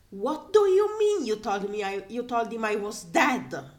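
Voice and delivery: loud, furious disbelief; outraged yell. Acted. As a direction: shout it down the com in outrage. Outraged character yelling at com.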